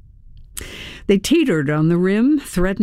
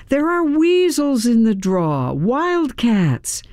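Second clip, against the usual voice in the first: raised, higher-pitched warning call; calling out loudly; 6 semitones higher than usual. A narrator's reading. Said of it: "I called out to him in a loud voice".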